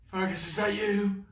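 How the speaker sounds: high, loud hopeful call. Acted. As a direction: call across the cavern, hoping. Mouse calls out.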